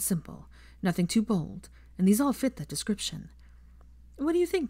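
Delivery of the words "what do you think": put-on lifted, higher young woman's voice; a hint of bright Hermione. Hermione asking Draco about sketches, lightly voiced.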